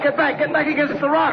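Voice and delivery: very high, frantic order; panicked shout to get back; urgent yelled command. Acted. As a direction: bark him back to safety. Shouting warning during rock slide.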